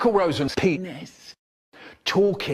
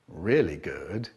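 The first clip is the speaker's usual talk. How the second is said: slow, softer, contented murmur; quiet pleased; 8 semitones lower, 5 dB quieter, slower than usual. Shown as a demonstration. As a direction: savour it quietly and slowly. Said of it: "repeated line, second ending differs".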